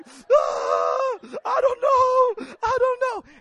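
loud, high acted bawling; crying. Preacher re-enacting himself crying before friends, high loud wailing. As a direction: wail the words through mock sobs. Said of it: "And I'm crying, I'm crying".

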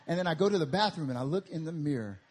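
ordinary talk

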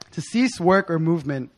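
usual speaking voice

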